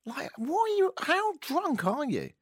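high, disbelieving what-are-you-on voice; high, slow mock disbelief; incredulous mock outburst. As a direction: squeak out your disbelief. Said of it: "wouldn't you just go".

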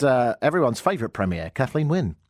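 normal talking voice

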